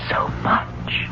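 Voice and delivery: breathy awed whisper. Acted. Ghost daughter whispering to her family in awe. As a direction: breathe it out in wonder.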